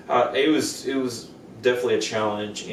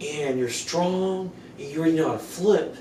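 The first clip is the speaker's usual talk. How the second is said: higher-pitched coaxing; eager persuasion; 6 semitones higher than usual. Retold or imitated. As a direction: imitate a friend selling the idea point by point. Friend eagerly persuading him, retold.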